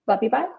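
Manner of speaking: BYE lifted at the end like a question; questioning sign-off. Real, not acted. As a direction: say "Love you, bye" as if asking whether you're done. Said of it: "You said it like it was a question".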